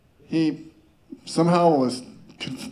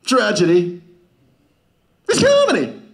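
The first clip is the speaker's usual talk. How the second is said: mock Shakespearean; 3 semitones higher, 5 dB louder than usual. Mock Shakespeare line, high finish.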